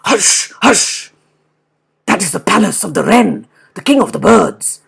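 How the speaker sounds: fast, urgent wolf voice; wolf warning the bear, hurried; wolf hushing. Acted. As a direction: hush the bear quickly, like a nervous wolf. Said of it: "said the wolf".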